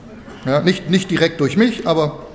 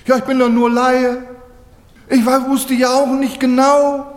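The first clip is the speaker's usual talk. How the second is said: defensive diver; 6 semitones higher, 3 dB louder than usual. Retold or imitated. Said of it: "Und da sagt doch einer".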